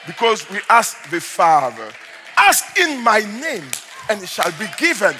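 slow build to a high shouted cry; slow down and climb to a shout; building preaching. Acted. Pastor urging the church to ask the Father, slow build to a shout.

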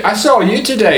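single someone out in a high Australian-ish brogue; mixed-up comic accent. Acted. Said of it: "He's Australian and Scottish".

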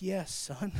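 low, soft, fatherly answer from God; hushed voice of God; voice of the Lord. Retold or imitated. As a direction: answer gently as God to a son. Voicing the Lord's reply.

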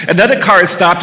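plain reading voice

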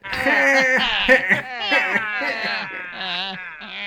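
long, high burst of laughter; big laugh. Real, not acted. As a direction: crack up helplessly at the bad guesses. Host cracks up at guesses.

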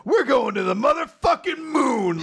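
a rallying war cry for the new arc; full-throated hype yell; hype shout. Acted. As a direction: shout it high and hyped. Hype moment, acted shout.

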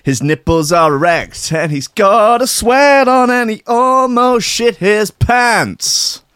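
high, slow hype announcer; drawn-out show intro announcement. Acted.

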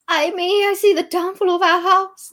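high, loud tragic cry; theatrical lament. Acted. Queen seeing the downfall of her house, theatrical lament. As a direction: wail it high and loud, like a stage tragedy.

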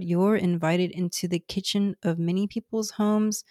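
normal talking voice

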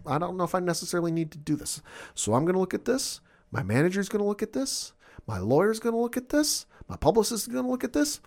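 mock Lashley listing who will review it, climbing; lightly voiced smug Lashley, rising; imitating Lashley, building. Lashley list, rising each line.

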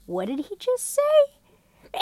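high, slow baffled voice; a stunned mockumentary aside; bewildered squeak; dry sitcom reaction. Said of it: "very dry, very, like".

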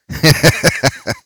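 high, rapid stage cackle; theatrical chuckle. Acted. Host relishing the cosmic cookie jar, theatrical cackle. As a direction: cackle playfully like a showman.

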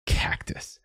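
"Cactus" breathed out in a scornful hiss; a scoffing, hushed "Cactus"; sneering. Acted. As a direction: spit "Cactus" back as a mocking jab. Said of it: "he sneered".

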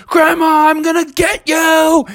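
high, strained cartoon threat; worked-up, high-pitched warning; angry threat. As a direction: vow revenge on grandma at high pitch. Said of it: "And Bubby was like".

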